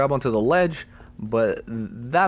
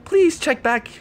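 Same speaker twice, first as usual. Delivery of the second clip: high, animated outro; bouncy, raised-pitch video outro; upbeat sign-off; 9 semitones higher, 4 dB louder than usual. Real, not acted. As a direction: thank viewers with high energy.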